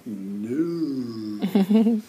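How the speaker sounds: long, hammed-up refusal that gets cut off; attempted refusal. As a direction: stretch the word out as a mock protest. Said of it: "I went to go like".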